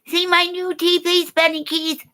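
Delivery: very high squeaky put-on voice, fading to near silence; high mock diva voice cooing over her teeth; mocking Cher chick. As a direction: ham up a vain starlet showing off new teeth. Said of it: "be like that Cher chick".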